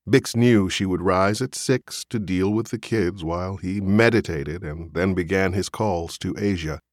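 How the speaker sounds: ordinary delivery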